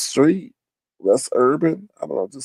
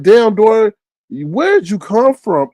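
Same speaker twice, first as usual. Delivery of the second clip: high, louder, drawn-out puzzled question; bewildered; 7 semitones higher, 4 dB louder, slower than usual. Acted. Asking Dory about turning up out of nowhere, bewildered. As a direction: demand an explanation, baffled. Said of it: "we are just bewildered".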